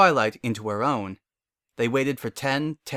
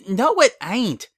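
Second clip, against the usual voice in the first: sudden objection; 10 semitones higher, 5 dB louder, slower than usual. A narrator's reading. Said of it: "the cow pony said suddenly".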